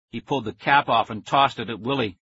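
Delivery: plain reading voice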